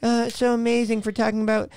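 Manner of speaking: mock fawning. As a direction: gush like an adoring fan, mockingly. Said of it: "And it's like".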